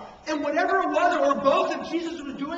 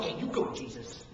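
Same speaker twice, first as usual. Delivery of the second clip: whispered cheer, go on Jesus; parents egging him on; 6 dB quieter than usual. Retold or imitated. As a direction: hush it out like an approving parent. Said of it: "every parent would have gone".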